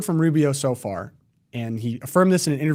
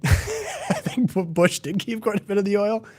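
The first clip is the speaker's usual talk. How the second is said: laughing through the words, pitched up; 7 semitones higher than usual. Real, not acted. Co-host laughing while correcting the Trump quote. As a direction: break into a laugh mid-sentence. Laughing at Bush keeping oil.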